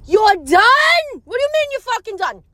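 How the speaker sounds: high, loud incredulous outburst; outraged disbelief. Retold or imitated. Acts outraged reaction to friend's text.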